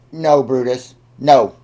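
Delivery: sharp loud no to a dog; firm scolding. Real, not acted.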